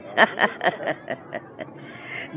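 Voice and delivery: breathy, airless scheming chuckle; a gloating aunt sniggering over the plot; villainous laugh. Acted. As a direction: laugh like you've already won.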